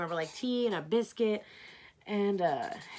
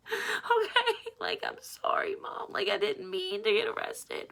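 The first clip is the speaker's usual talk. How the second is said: retold tearful apology; 11 semitones higher, 3 dB quieter than usual. Retold tearful apology to her mother.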